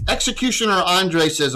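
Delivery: ordinary delivery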